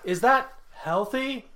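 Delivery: high, slow worried question; character impression. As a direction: ask it high and drawn out. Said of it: "Or the other one".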